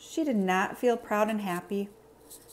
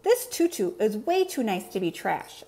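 ordinary delivery, nothing marked

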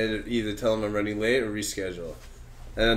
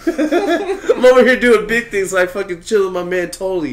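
higher, slower, louder, laughing through it; laughing brag; 8 semitones higher, 8 dB louder than usual. Real, not acted. Friend joking about chilling with Tully, laughing as he talks. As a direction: brag while cracking up.

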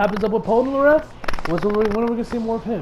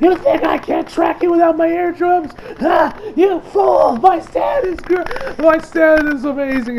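high, loud mock-furious boasting; hammed-up villain rant; 8 semitones higher, 4 dB louder than usual. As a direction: boast at the foe in mock outrage.

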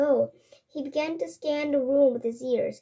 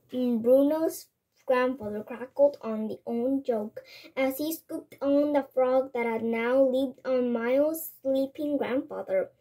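ordinary delivery, nothing marked

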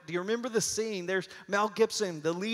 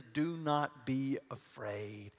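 slow, soft and soothing; gentle reassurance; 4 semitones lower, 5 dB quieter, much slower than usual. Shown as a demonstration. Preacher soothing the frightened congregation, slow and soft. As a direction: say it slowly to calm a frightened crowd. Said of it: "The most common command ... is".

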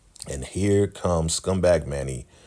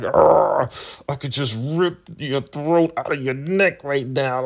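exasperated higher-pitched mock growl; 恼怒低吼模仿; 8 semitones higher than usual. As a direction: growl it like you're at your wit's end. Daikon enraged at River.

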